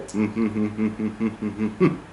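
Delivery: string of evenly spaced, level hums; hummed German rhythm. Shown as a demonstration. Teacher shows how Germans say it, level even humming. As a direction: hum "mm-mm-mm" on one note, evenly timed. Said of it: "Germans tend to say".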